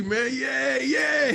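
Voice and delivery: high, loud yay cheer. Real, not acted. Cheering at start of interview.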